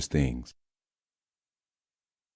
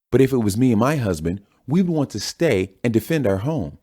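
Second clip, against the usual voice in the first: higher, polished TV host questioning; news anchor voice; 8 semitones higher than usual. Acted. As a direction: press the panel like a cable host.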